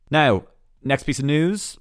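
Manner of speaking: normal talking voice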